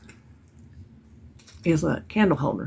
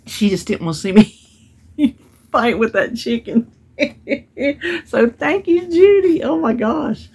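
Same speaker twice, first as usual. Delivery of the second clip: higher and louder, laughing and near tears; laughing thanks; 6 semitones higher, 5 dB louder than usual. Real, not acted. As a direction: thank her through laughter. Thanking Judy, laughing and moved.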